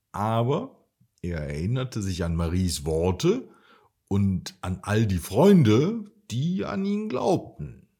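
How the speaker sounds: ordinary delivery, nothing marked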